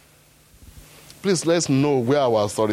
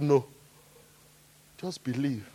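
gentle reply; 8 dB quieter than usual. Retold or imitated.

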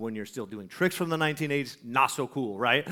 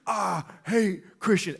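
drawn-out, raised-pitch mock snarl; snarling hostility; 4 semitones higher, 4 dB louder, slower than usual. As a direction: imitate a hostile sneer, stretched out slowly. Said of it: "like, a snarl on his face".

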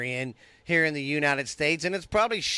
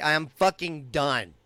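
normal talking voice

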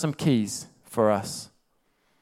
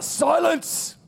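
much higher commanding mock yell; Lucifer yelling silence; 14 semitones higher than usual. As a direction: cut the crowd off with one word. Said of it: "he just yells out".

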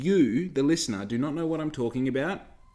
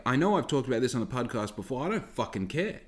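normal talking voice

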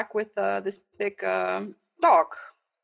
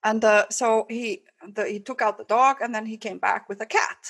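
ordinary delivery

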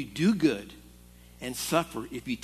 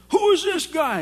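raised, higher mock outburst of an angry boss; indignant demand; 12 semitones higher, 7 dB louder than usual. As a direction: imitate the boss barking the question at the worker. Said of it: "he walks back there".

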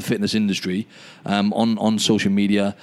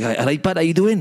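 higher-pitched imitated Welsh accent; Gareth's Welsh accent; 8 semitones higher than usual. Welsh stranger coming over to greet him, imitated Welsh accent. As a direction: put on a lilting Welsh greeting, climbing in pitch. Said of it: "Welsh accent".

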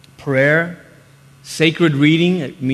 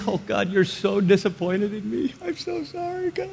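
tiny high whimper, much softer; hammed-up weepy voice climbing higher; mock weeping; 4 semitones higher, 8 dB quieter than usual. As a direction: squeak out a tearful apology to God. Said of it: "I was weeping".

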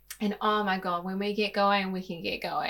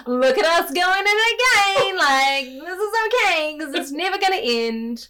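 higher, louder laughing exclamation; laughing relief; 8 semitones higher, 10 dB louder than usual. Retold or imitated. Laughing with partner after a fight, higher and louder. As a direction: laugh at the two of you. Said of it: "I'm like".

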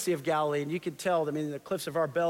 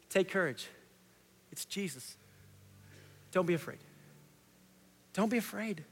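gentle reassurance; 6 dB quieter than usual. Real, not acted. Repeating Jesus' words to listeners.

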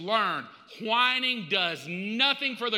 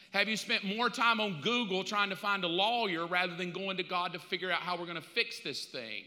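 ordinary delivery

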